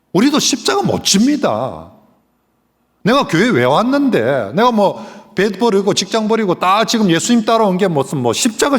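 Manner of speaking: high, loud, furious refusal; loud high outraged rant; raging protest. Retold or imitated. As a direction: Peter ranting in dialect, beside himself. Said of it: "베드로가 막 갈갈이 날뛰고".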